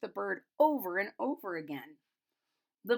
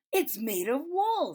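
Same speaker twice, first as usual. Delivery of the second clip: put-on excited child; 4 semitones higher, 4 dB louder than usual. A narrator's reading. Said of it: "said the other".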